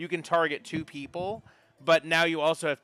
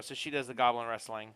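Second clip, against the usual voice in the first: ordinary talk, nothing marked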